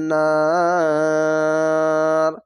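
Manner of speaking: নার held very long, three counts; three alif stretch. Shown as a demonstration. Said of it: "তিন আলিফ টেনে পড়বেন".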